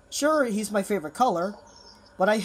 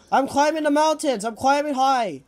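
raised, higher voice while fleeing; pitched-up, urgent announcement; 5 semitones higher, 5 dB louder than usual. Real, not acted. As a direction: call out the escape loudly. Fleeing the monster up the mountains.

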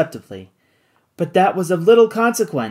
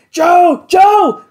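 very high, loud alarmed call; crying out a name; 12 semitones higher, 8 dB louder than usual. A narrator's reading. Kennedy calling after Joe falling overboard, high loud cry. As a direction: cry out as he goes over the edge. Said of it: "cried Kennedy".

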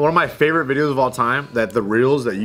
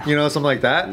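ordinary talk, nothing marked

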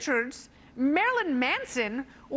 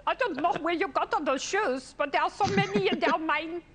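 hammed-up French-Canadian accent; 3 semitones higher than usual. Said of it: "that was a horrible accent".

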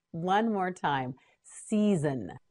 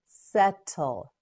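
"Settle" slowly as set, tul; syllable by syllable. Shown as a demonstration. Teacher models word by syllables.

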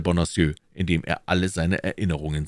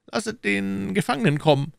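higher, pressing questions as the Cardinal; Cardinal questioning. Acted. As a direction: interrogate his agent coolly. (der Kardinal, verhörend)

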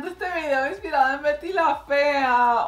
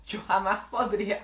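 usual speaking voice

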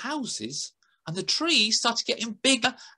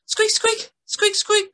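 high squeaks; 4 dB louder, slower than usual. A narrator's reading. Squirrel noise in story.

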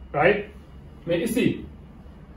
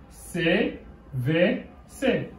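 slow spelled letters; 5 dB quieter, much slower than usual. Shown as a demonstration. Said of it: "teacher spells letters slowly".

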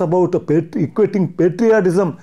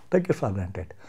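a sarcastic whispered concession; 8 semitones lower, 10 dB quieter than usual. Real, not acted. Speaker to the TV anchor, conceding in a whisper. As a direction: repeat it in a whisper, much softer.

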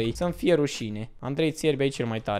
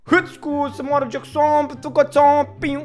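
imitating a very loud, high street yell; hammed-up shouting like the loud vlogger; mock loud Dorian; 12 semitones higher, 5 dB louder than usual. Mocking the vlogger who always talks loud, with a high yelling voice. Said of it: "vorbește așa de tare".

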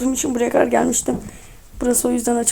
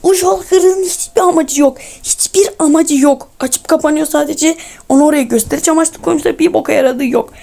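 angry rant; 3 semitones higher, 3 dB louder than usual. Real, not acted. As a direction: rail against the pointless obstacle. Rants about a useless game block.

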